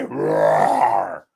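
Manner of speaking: low growling bear roar. Pretend. Trader doing the bear sound for the chat, a low roar. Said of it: "to do the caboose sound, the bear sound".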